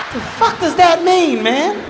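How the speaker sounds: high, drawn-out incredulous cry; baffled outrage. Acted. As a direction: stretch it high in acted disbelief. Boy baffled by dad's remark.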